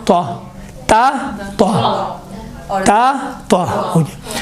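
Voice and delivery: تا made thick and heavy like طا; teacher thickening تا into a heavy sound. Shown as a demonstration. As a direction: say تا with a full heavy back tongue. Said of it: "اسی کو موٹا بنائیں".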